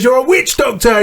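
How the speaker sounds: high-pitched mock accusation; astonished. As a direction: imitate someone amazed and spooked. Friend's amazed accusation, retold.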